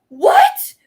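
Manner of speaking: gasp, then a high, disbelieving what; mock gasp. Mocking people who just learned this, gasping in mock shock. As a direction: fake shock at a ridiculous claim.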